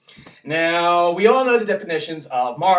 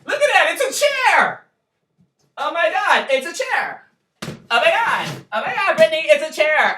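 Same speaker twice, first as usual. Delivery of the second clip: much higher, squealing; mock amazement; 10 semitones higher, 4 dB louder than usual. Vlogger gushing over his chair in mock awe, high. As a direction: gush over a plain chair.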